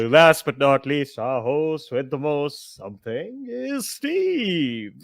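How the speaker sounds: slow, drawn-out host intro; announcer introduction. Pretend. Host introduces the last player, drawn-out announcer style. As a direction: stretch it out like a ring announcer, climbing on the name.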